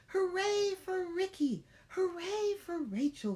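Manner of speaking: high, loud cheering animals. Acted. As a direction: cheer like a happy crowd. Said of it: "they said".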